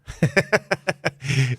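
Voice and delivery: a loud high laugh breaking out; big burst of laughter. Real, not acted. Laughing at moving in with his old business partner. Laughs at own awkward story.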